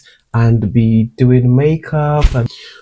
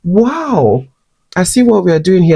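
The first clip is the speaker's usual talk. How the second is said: mock-impressed wow, pitched higher; a knowing sarcastic aside, higher than usual; 7 semitones higher than usual. Singer answering a lecturer's insult with higher mock-impressed sarcasm. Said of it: "And I was like".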